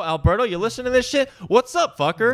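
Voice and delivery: high, excited shout-out; hyped shout-out. Real, not acted. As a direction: call him out, hyped. Calling out to a listener.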